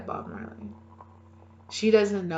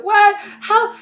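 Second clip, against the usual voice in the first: shrieked, much higher and louder; mock shock; 14 dB louder than usual. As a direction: shriek in disbelief. Retold shock at webpage.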